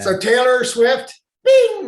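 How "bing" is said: high, loud sung-out chime; high bing. Acted. Host announcing a pop-up picture, high chime. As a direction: ring it out like a bell. Imitates an on-screen effect chime.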